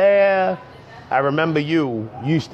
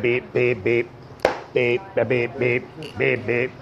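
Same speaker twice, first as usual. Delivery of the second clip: low, monotone beep chant; low robotic beeper noises; beeper imitation; 5 semitones lower, 4 dB quieter than usual. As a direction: chant like a little beeping machine.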